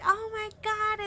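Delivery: high-pitched excited oh my God; giddy shock. Retold or imitated. Fans flustered by the dance question, high and giddy. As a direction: squeal it, flustered. Singer asks about dance move.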